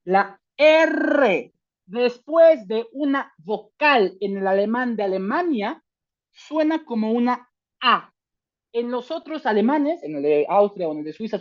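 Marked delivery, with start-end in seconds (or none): none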